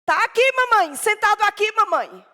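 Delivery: put-on high, loud childish telling; child describing. Boy describing the man to his mother, high and loud. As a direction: tell it like an eager little boy.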